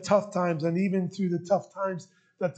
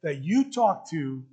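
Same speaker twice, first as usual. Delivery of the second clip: normal talking voice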